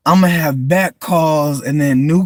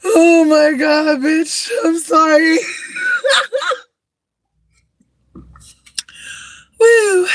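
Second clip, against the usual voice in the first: laughing; 13 semitones higher, slower than usual. Real, not acted. Laughing again.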